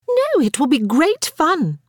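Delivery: high, drawn-out enthusiasm; insisting. A narrator's reading. Harriet overrides Sarah's plea not to, insisting. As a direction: insist on the fun of it. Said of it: "Harriet insisted".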